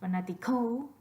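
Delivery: fanático wrongly stressed fanatiCÓ, as a question; questioning fanático with a rising, stressed last syllable. Shown as a demonstration. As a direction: push the stress onto the final "co" of fanático. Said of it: "your voice stress on the last syllable".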